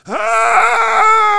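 high, loud hammed-up yelp of pain; cartoonish yelp as the broomstick hits; mock scream of pain. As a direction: let out a big fake pained scream. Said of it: "Guy goes".

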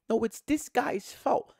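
high, slow mock accusation; high-voiced blamer. Fan imitating fans picking someone to blame, high accusation.

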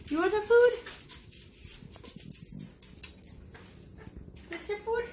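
high pet-talk voice. Real, not acted. Owner teases the hungry dog, high baby talk.

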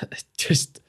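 tearful, breaking into a whisper; fighting back real tears; choking up. Real, not acted. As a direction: voice catching on the verge of tears. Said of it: "I'm trying not to get emotional".

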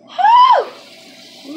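high loud whew of disbelief; exasperated whoop. Real, not acted. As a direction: let out a shrill exasperated whoop. Venting exasperation, big exclamation.

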